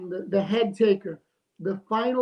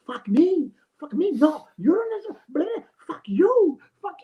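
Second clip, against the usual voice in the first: mock Spanish-accented swearing, higher and louder; imitating Spanish accent; 7 semitones higher, 4 dB louder, slower than usual. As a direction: spit it out like the angry guy on the phone in a Spanish accent. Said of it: "with some sort of Spanish accent".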